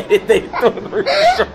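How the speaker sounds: high laughing shout. Real, not acted. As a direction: burst out laughing at him. Laughing at friend's mistake.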